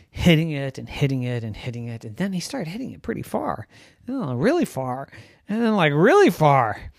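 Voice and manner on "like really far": ordinary delivery, nothing marked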